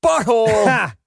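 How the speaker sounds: slow, shouted hammy line read; bad acting. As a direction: overact it, dragging the words out. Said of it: "That was terrible".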